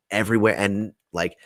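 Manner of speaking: ordinary delivery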